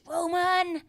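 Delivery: high, loud mock yell; imitated angry scream. Podcaster imitating a father calling his son in, mock scream. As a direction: holler the name like a summons. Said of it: "So then he screams".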